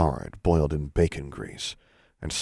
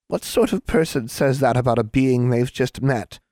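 higher, offended question; reproachful hag; 6 semitones higher than usual. A narrator's reading. Hag to the girl who insulted her, reproachful.

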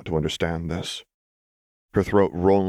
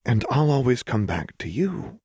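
restrained, higher-pitched promise; Lux; 7 semitones higher than usual. A narrator's reading. Lux echoing Jinx's promise instead of groaning, restrained. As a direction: hold back the groan, keep it gentle. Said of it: "forced herself not to grit her teeth and groan".

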